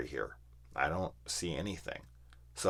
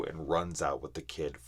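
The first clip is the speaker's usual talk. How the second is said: usual speaking voice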